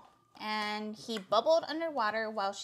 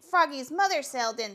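plain reading voice

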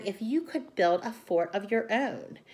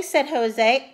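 ordinary delivery, nothing marked

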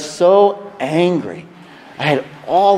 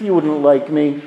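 ordinary talk

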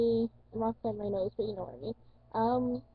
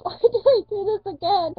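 much higher, loud exasperated cry; exasperated yell; 9 semitones higher, 9 dB louder than usual. Retold or imitated. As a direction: yell at yourself in disbelief. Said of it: "I'm like".

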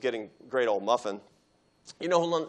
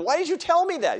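high, loud shocked protest; outraged disbelief; 6 dB louder than usual. Retold or imitated. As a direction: blurt it out, appalled. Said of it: "I said".

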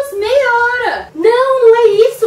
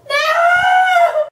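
panicked shout; 9 semitones higher than usual. Acted. Realizes she overslept.

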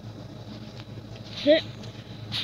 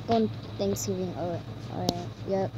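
muttering; 6 semitones lower, 10 dB quieter, faster than usual. Real, not acted. Muttering to himself in game.

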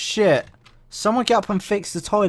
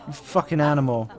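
ordinary delivery

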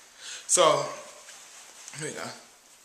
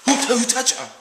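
higher, louder startled cry; startled shout; 8 semitones higher, 7 dB louder, faster than usual. Acted. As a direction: jump at a touch in the dark. Startled in the dark theatre.

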